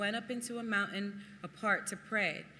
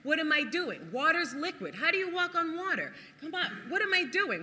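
higher, rising alarm; panicked self-talk; 8 semitones higher, 3 dB louder than usual. Retold or imitated. Peter doubting mid-walk on the water, panicky. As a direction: fret aloud as the ground gives way. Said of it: "five senses kicking in".

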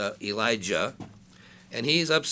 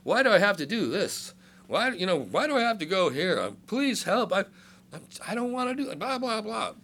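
higher, whining pleading; whiny prayer; 6 semitones higher than usual. Pretend. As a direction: beg like a complaining child. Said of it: "It's like".

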